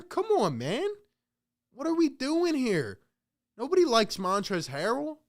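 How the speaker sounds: high-pitched, disbelieving complaint; can't believe what the coach is doing; incredulous rant. Real, not acted. Fan ranting at Doc for shaking hands, incredulous.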